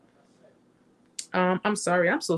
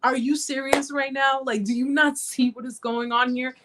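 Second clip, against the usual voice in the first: higher-pitched, exasperated disbelief; mock-incredulous scolding, pitched up; frustrated God; 6 semitones higher than usual. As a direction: imitate someone who can't believe what they see. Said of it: "even God was getting frustrated with me".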